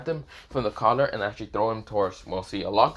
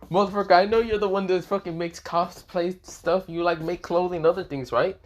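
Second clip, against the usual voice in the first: higher-pitched, pushy acted retort; exasperated insistence; 7 semitones higher than usual. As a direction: push back at a stubborn friend.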